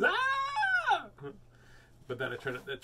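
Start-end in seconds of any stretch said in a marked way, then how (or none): 0.0-1.1 sudden high shriek; imitated scream. Imitating the startled neighbour screaming, a high shriek.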